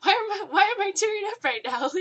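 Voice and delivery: overwhelmed with joy, tearing up; retelling tearing up after a compliment, laughing and teary; happy tears. Retold or imitated. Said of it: "Rachel's crying 'cause she's so happy".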